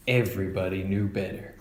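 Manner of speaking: ordinary delivery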